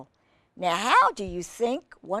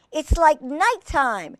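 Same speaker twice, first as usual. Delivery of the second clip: cried out; 8 semitones higher, 5 dB louder than usual. A narrator's reading. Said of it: "cried the sea urchin".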